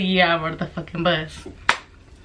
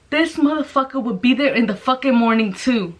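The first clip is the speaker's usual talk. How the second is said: ordinary talk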